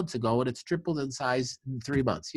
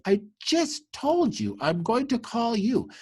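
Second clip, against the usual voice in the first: much higher, slow and exasperated; a sister's fed-up retort, pitched up and drawn out; 13 semitones higher, 3 dB louder than usual. Retold or imitated. Sister snapping back at the clinic's front desk, higher and slow.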